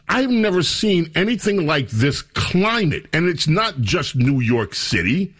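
ordinary talk, nothing marked

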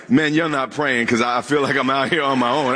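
ordinary talk

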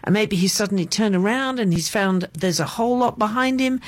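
ordinary talk, nothing marked

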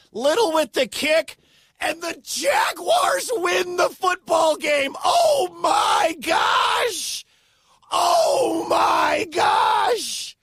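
soaring, shouted disbelief at the winning kick; ecstatic call. Real, not acted. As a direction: scream it like the stadium just exploded. Walk-off winning kick called live.

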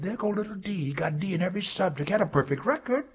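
put-on high taunting child voices; high sing-song playground taunt; mocking schoolkids. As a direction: tease like playground bullies.

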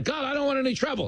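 high, fast mock panic; hammed-up anxious surrender; flustered tourists. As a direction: hurriedly give in like a nervous tourist. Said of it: "And they're like".

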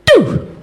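high falling laser-like pew; short, very high zap; imitated signal chirp. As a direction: mimic a laser shot. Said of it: "the first thing I hear is".

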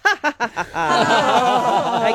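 a free open laugh; releasing laugh. Shown as a demonstration. Teacher leads a release laugh for the radio hosts. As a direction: laugh let go after tightening. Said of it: "let it go with a laugh".

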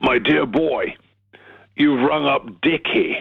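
a hint of a plummy booming elder; a gentle take on a booming old gent; booming Richard Attenborough. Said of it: "This booming voice comes back".

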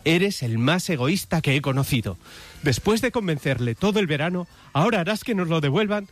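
indignant mother's reproach, rising in pitch; tearful reproach. Acted. Mother scolding her husband over their son, indignant and rising. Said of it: "también con lágrimas en los ojos, le dice".

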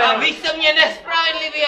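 hlasitá vyčítavá výčitka, výš; vykřič křivdu; shouted complaint. Pretend. Raněný křičí výčitku. (raněný vyčítá instruktorovi ranou hlasitě)

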